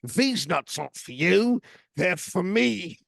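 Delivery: high, slow hammed-up Cockney; mock Cockney accent. Second take of a Cockney accent for friends on a call. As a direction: lean right into the Cockney. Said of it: "this is my Cockney".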